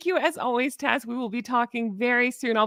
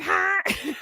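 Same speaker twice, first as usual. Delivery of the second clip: laughing mock honk, higher; imitating a honk; 7 semitones higher than usual. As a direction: retell his honk, laughing. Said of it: "he did honk".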